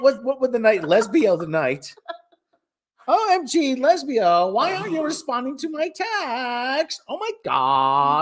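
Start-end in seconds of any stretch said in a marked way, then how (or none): 3.1-8.2 put-on whiny, swooping Valley Girl; Valley Girl accent. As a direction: read the text out with Valley Girl drama. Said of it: "have a Valley Girl accent".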